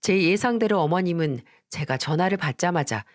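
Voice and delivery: usual speaking voice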